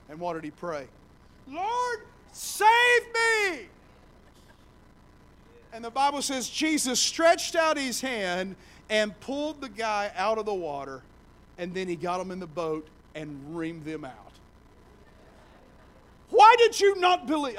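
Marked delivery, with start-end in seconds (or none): 1.5-3.7 high, loud shout for help; desperate cry. Acted. Peter sinking in the waves, shouting for rescue. 16.3-17.6 high, loud scolding; rebuke. Acted. Jesus scolding the disciples, loud rebuke.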